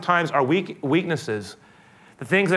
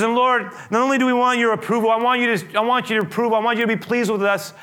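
preached prayer; 6 semitones higher than usual. Acted. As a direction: lift the prayer up with force. Voices Moses' prayer, preaching high.

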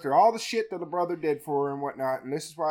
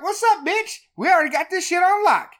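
much higher, louder cocky greeting; sassy swagger; 11 semitones higher, 6 dB louder than usual. Retold or imitated. The three women arriving late, cocky and much higher. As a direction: strut in like you own the place. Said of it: "They're like".

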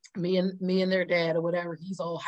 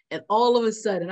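ordinary talk, nothing marked

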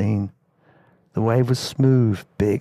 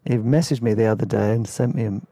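ordinary talk, nothing marked

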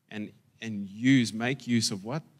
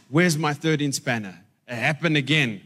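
demanding husband; 4 semitones higher, 6 dB louder than usual. Retold or imitated. Husband demanding spanner in story.

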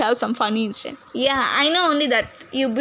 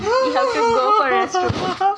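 shouting; 8 semitones higher, 3 dB louder than usual. Acted. Urgent restroom shout.

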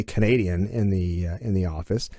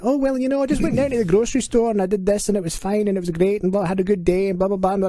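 much higher, chattering Scottish mum; mother's everyday Scottish accent; 11 semitones higher, faster than usual. Acted. Mother chatting to family normally, high Scottish accent. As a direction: imitate a Scottish mother nattering, high-pitched. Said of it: "she's talking to us normally".

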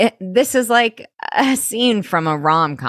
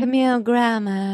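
airy Camille Grammer; 4 semitones higher than usual. Retold or imitated. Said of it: "the airy quality to her voice".

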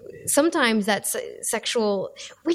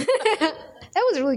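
creepy voice; 7 semitones higher, slower than usual. Acted. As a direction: say it like a lurking creep. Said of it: "that's my creepy voice".

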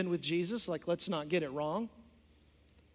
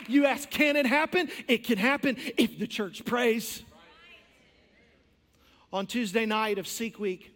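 ordinary delivery

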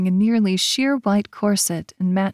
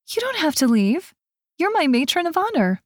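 much higher, pleading; insistent bride; 9 semitones higher than usual. A narrator's reading. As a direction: keep her friend from leaving. Bride protesting friend leaving.

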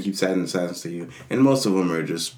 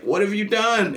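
shocked assistant; 11 semitones higher than usual. Retold or imitated. Gamer mimics the shocked assistant, a high dismayed cry. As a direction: gasp it out in horror. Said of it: "the assistant's like".

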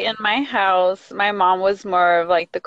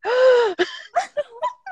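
high, drawn-out gasp; long dramatic intake of breath; mock shocked gasp. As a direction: gasp in pretend dread. Acting out her shock at dad.

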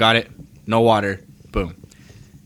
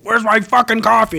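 high, louder impatient demand; imitating a customer yelling at the barista; mock angry customer; 11 semitones higher, 4 dB louder than usual. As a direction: snap it like an irate customer. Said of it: "people are standing like".

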